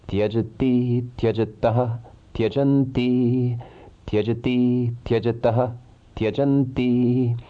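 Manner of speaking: even, measured chanting of verb forms; rhythmic recitation. Shown as a demonstration. Teacher reciting Sanskrit verb forms for students, in a measured chant. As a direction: recite each form in a steady rhythm, like a class drill.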